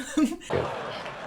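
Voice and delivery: a high airy burst of laughter; high breathy laugh. Real, not acted. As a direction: let out a high giggle. Amused by Cheryl's wit.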